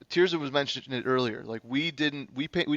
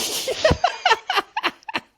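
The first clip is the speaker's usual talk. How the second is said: loud laugh; 6 dB louder than usual. Real, not acted.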